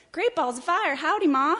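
high, loud gosling voice; baby goose. Acted. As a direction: holler an excited greeting to mama. Newborn gosling greets its mama.

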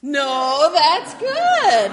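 high, loud bright answer; cheerful contradiction. Acted. Reading to kids, loudly bright that it's good. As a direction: flip it to good with a grin.

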